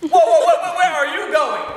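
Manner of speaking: high, loud stammered whoas; alarmed protest. Acted. Husband stopping his wife walking off, alarmed. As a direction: halt them with a loud, high burst.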